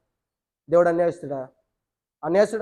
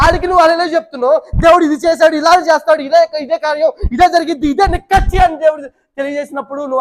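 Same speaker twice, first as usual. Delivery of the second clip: high, loud sermon delivery; fiery preaching; 5 semitones higher, 6 dB louder than usual. Acted. Preacher rebuking the congregation, high and loud.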